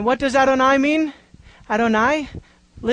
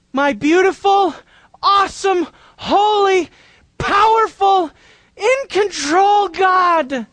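fervent praise; 6 semitones higher, slower than usual. Acted. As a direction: lift each name of God in awe. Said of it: "He ascribes to God the Father".

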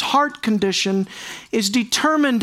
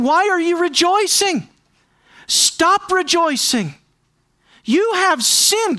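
voicing the prophet's rebuke; 7 semitones higher than usual. Preacher voicing Hosea's rebuke.

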